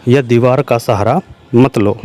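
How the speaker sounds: ordinary talk, nothing marked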